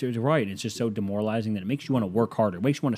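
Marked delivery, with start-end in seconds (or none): none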